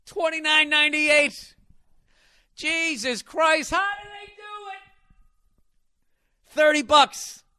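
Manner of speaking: high, loud, slow disbelief shout; mock amazement. Hamming up the ad, he shouts the roses deal price high and loud in mock disbelief. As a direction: shout the price like it's unbelievable.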